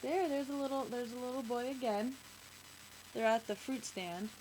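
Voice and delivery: ordinary delivery, nothing marked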